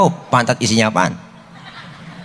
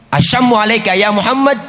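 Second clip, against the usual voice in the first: mock insult; 6 semitones higher, 3 dB louder than usual. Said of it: "balikin aja".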